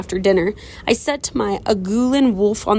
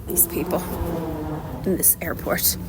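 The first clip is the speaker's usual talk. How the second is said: hushed aside in a whisper; real whispered aside; 3 semitones lower, 9 dB quieter than usual. Real, not acted. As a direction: whisper so the airport won't hear. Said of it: "unvoiced whisper".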